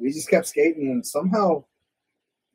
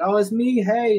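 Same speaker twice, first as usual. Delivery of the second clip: lightly put-on higher voice; re-enacted greeting; 9 semitones higher, 4 dB louder than usual. Visitor answers friend's mom in the next room, innocent call. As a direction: call back up to an unseen parent. Acts own reply to shouting mom.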